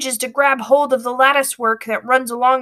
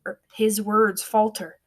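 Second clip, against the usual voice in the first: usual speaking voice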